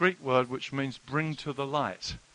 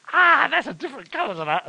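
high startled cry of discovery; startled dismay; 13 semitones higher, 10 dB louder than usual. Retold or imitated. Preacher retelling the shirt under TV lights, high startled cry. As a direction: squeal it like you've just seen the stain.

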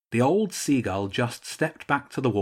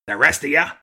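louder, higher order to the troops; loud command; 5 semitones higher, 7 dB louder, faster than usual. Acted. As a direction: call out to the soldiers. Archduke ordering his soldiers away.

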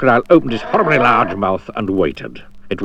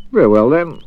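lightly raised, cheery adventurer; a bright eager guide, a touch higher; Mister Daydream voice; 4 semitones higher, 6 dB louder than usual. Acted. Mister Daydream inviting Jack along, cheery and raised.

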